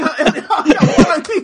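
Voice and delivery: giggly imitation of a street-tough voice; laughing gangster impression. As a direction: crack up while doing the Chappie thug. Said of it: "those two motherfuckers from Chappie".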